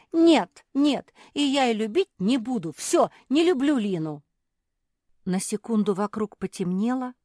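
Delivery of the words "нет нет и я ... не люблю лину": put-on high, loud child shout. A narrator's reading. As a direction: scream it in a tantrum. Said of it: "закричала".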